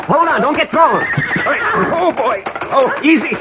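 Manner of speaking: high, urgent calls to steady the horse; calling out. Acted. Man hauling on a bolting horse, urgent high calls. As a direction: shout over hooves to hold it. Trying to stop a runaway horse.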